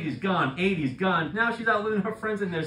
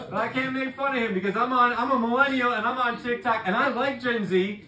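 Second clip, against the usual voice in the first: ordinary talk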